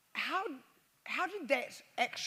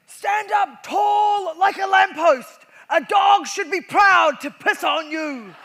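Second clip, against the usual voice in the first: slow, much louder, higher bellow; drill sergeant; 9 semitones higher, 16 dB louder, slower than usual. Retold or imitated. Sergeant major ordering recruits to stand tall, slow bellow. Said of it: "the second thing he ever said to us".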